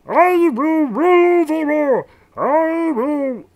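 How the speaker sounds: very high, shouted villain boast; loud cartoon world conqueror; Marvin the Martian. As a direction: belt it slowly in a squeezed alien voice. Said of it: "It's a little off".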